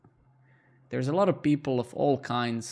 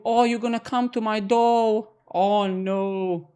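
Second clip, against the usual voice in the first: higher, louder hammed-up fright; mock fear; 6 semitones higher, 4 dB louder, slower than usual. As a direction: pretend to be scared of the viewer. Mock fear of viewer threat.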